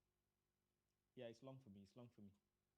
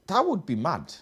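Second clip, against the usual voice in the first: higher, louder amazed exclaim; 7 semitones higher, 6 dB louder than usual. Real, not acted. Teammate imagining Spurs sacking their manager, amazed exclaim. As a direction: marvel out loud at the idea.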